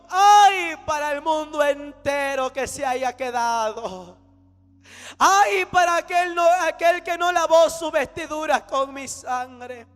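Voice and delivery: very loud, drawn-out prophetic cry; thundering woe. Retold or imitated. The Lord pronouncing woe on those left behind, thundering proclamation. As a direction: proclaim doom at full force, stretching each opening cry.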